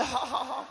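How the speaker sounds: high mock "ha ha ha"; hollow copycat laughter; fake laugh. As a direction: imitate someone laughing along cluelessly. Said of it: "ikut ketawa aja".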